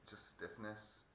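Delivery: ordinary delivery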